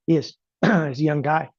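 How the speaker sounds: ordinary talk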